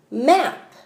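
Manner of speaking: "Map" said quickly and blended; said fast. Shown as a demonstration. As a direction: snap "Map" out fast in one go. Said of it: "do it slowly and then fast".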